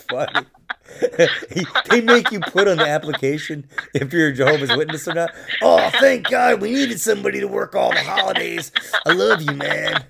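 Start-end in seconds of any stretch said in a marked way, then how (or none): 5.6-10.0 overjoyed mock employer; mock grateful boss.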